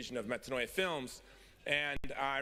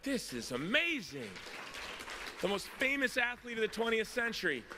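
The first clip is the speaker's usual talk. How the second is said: awestruck; 9 semitones higher than usual. Retold or imitated. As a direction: marvel at it, stretching the words. Acting out awe at Pele's talk.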